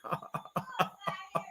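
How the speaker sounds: breathy high giggle; quiet high laugh at the chat. Real, not acted. Laughs reading Tasha's comment.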